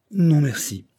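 high, airy acted sigh; sighed refusal. A narrator's reading. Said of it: "soupira la jeune femme".

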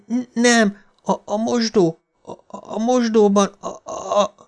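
high, broken stammer near sobbing; choking on the words in panic; stammering terror. A narrator's reading. Frightened girl stammers to her father, high and near tears. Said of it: "hisztérikus zokogásban tört ki".

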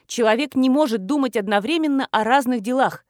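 ordinary delivery

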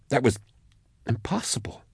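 voiceless, hushed shock; whispered disbelief. A narrator's reading. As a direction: whisper it, barely able to believe it. Stunned disbelief at what she saw.

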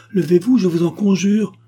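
high, loud, imploring outburst; urgent pleading. A narrator's reading. Marquise begs her kneeling mother to rise, pleading urgently.

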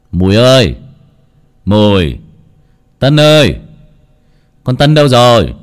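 loud calls across an empty yard; calling out. A narrator's reading. Old master calling for his servants, loud. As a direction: call the household names into the empty house. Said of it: "ông Phú cất tiếng gọi bâng quơ".

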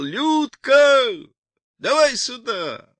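high, loud drawn-out call. A narrator's reading. Boris calls his sister over from afar, shouting. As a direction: holler across the yard. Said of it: "приложив к рту ладонь, закричал".

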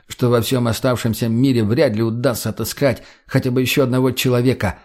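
plain reading voice

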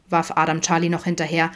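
ordinary delivery, nothing marked

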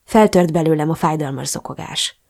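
plain reading voice

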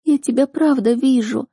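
put-on high girl's voice, near sobbing; tearful amazement. A narrator's reading. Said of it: "Девушка зарыдала, шмыгая носом". (высоко, сквозь рыдания)

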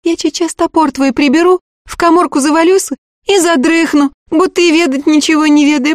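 put-on high, eager young servant girl; peasant girl voice. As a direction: pitch it up as a simple village girl. Girl plans his escape.

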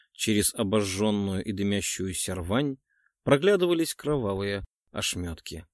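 ordinary delivery

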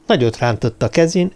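usual speaking voice

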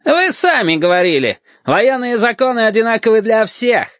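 high, forceful protest; loud retort. A narrator's reading. Lieutenant answers the captain before the crew, loudly. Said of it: "громко отозвался лейтенант".

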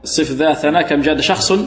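ordinary delivery

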